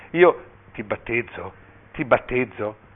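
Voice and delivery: slow, drawn-out solemn words; halting baptism formula. A narrator's reading. Bishop stuck on the baby's name before the congregation, halting and slow. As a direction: pause expectantly between the repeated words.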